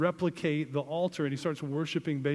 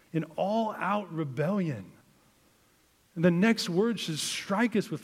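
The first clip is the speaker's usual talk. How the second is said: higher, louder preaching push; fired-up preaching; 5 semitones higher, 4 dB louder than usual. Acted. Pastor to the congregation about King Ahaz, fired-up preaching.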